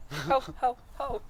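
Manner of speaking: high, loud mock Santa laugh. Friends joking about Christmas, mock Santa laugh. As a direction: playfully belt out a Santa chuckle.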